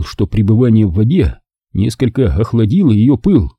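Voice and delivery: ordinary delivery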